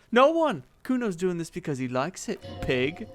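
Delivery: lightly voiced smug kid; a brat proud of himself; bratty kid Kuno. Kuno boasts to the cop, lightly bratty.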